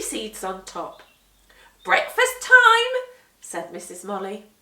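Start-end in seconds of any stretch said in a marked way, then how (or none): 1.8-3.0 much higher and louder, slow and sing-song; cheerful call. Acted. Missus Molly calling the children happily to breakfast. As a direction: call the whole house to eat.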